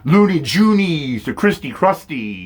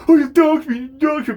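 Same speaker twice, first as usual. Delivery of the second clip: much higher, squeaky mocking whine; higher sing-song mockery of a complainer; whiny mock victim; 14 semitones higher than usual. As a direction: mimic her crying foul in a shrill put-on voice. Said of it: "and she been".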